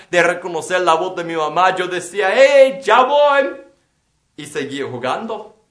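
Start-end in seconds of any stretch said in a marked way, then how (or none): 2.3-3.5 slow, loud, high shout back; shouted reply. Retold or imitated. Boy answering his mother from afar, loud slow shout. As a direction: yell it back over your shoulder.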